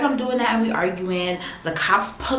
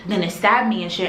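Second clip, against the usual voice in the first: ordinary talk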